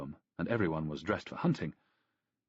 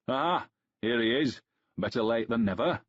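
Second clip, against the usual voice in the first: put-on strong Danubian accent; 4 semitones higher, 4 dB louder than usual. A narrator's reading. Host greets his late friend Ballista in a loud Danubian accent. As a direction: boom out the welcome in thick Danubian. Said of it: "the strong Danubian accent of Aurelian rang out".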